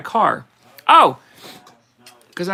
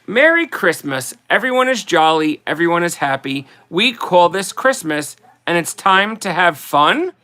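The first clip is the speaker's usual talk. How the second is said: poem reading; 4 dB louder than usual. Acted. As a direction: read it like a greeting card poem, unhurried. Reads Christmas poem aloud.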